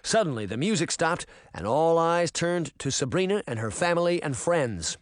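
ordinary delivery

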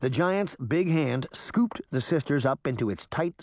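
ordinary delivery

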